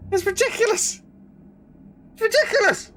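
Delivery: high, exasperated wail. Real, not acted. Ranting to chat about the lift lady, exasperated. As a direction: throw up your hands in disbelief.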